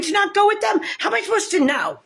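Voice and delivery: indignant outburst. Real, not acted. Indignant about deputies.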